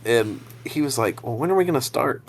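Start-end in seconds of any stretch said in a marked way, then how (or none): 1.2-2.2 higher, softer imitation of a kid; a hint of a kid's voice, raised and small; impatient kid. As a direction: lightly voice a kid asking when it begins. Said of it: "a kid I was with".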